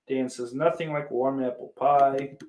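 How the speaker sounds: normal talking voice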